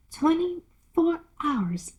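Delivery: put-on hushed, timid little girl. Alice whispering timidly to the Duchess. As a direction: ask it under your breath, shyly.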